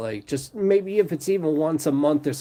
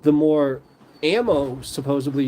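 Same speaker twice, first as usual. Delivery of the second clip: normal talking voice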